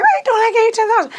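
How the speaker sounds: loud, high mock scolding; mad dad. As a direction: imitate an angry dad demanding answers. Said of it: "and he was mad".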